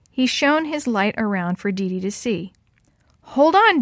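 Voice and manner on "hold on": high, loud call. A narrator's reading. Man at the hole to the trapped girl, calling down. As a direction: call down to someone below.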